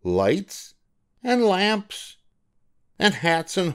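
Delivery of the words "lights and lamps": usual speaking voice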